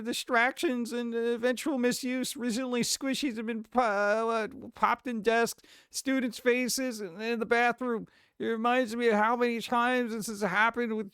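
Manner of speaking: reading a letter aloud. Acted. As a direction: read it out like a prim letter.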